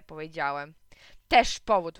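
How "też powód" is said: curt, loud brush-off; dismissive retort. A narrator's reading. Mother brushing off son's excuse, curt and dismissive. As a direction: wave his excuse away. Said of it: "wzruszyła ramionami mama".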